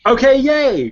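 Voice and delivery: high, loud burst of delight; delighted, high-pitched whoop; excited cheer. Real, not acted. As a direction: cheer the lucky roll, voice jumping up. Cheers a successful dice roll.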